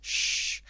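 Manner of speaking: "sh" as a long hushing English sh, lips rounded; "sh" said as a single voiceless sh sound. Shown as a demonstration. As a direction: hiss out "sh" like shushing someone. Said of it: "It's an S sound pronounced with a H way".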